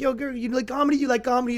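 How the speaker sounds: high, eager street catcall pitch; comedy catcaller. Retold or imitated. Catcaller pushing a comedy show on girls, high and eager. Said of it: "comedy catcaller".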